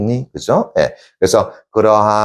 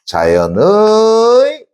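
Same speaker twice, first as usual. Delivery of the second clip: louder, high, long held ending; drawn-out reading; 11 semitones higher, 5 dB louder, much slower than usual. Shown as a demonstration. Teacher rereading the poem for class, drawn out. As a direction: stretch it out high and loud.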